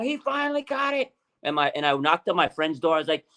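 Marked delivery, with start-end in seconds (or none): 0.0-1.1 high-pitched retold celebration; excited fan freaking out, reenacted. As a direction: squeal it in disbelieving triumph, without shouting. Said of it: "freaking out".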